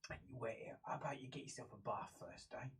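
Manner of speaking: low, very soft, half-whispered; hushed guard. Acted. Game master voicing guard sending visitor to wash, low murmur. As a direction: murmur the instruction under your breath.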